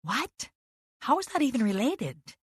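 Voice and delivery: high, disbelieving "What?"; baffled and defensive; incredulous. A narrator's reading. Incredulous reply in argument.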